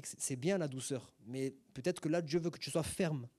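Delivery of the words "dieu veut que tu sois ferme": normal talking voice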